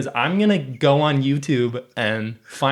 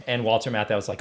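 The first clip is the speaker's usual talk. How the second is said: usual speaking voice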